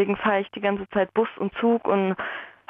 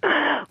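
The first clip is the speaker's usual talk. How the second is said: diabolical laugh; 6 semitones higher than usual. Real, not acted. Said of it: "welch diabolisches Lachen".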